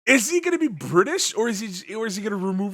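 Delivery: excited. Real, not acted. Excited rant about Knuckles.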